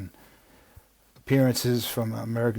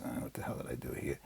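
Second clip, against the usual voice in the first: whispering; 11 semitones lower, 16 dB quieter, faster than usual. Real, not acted. Muttered aside while lost in notes.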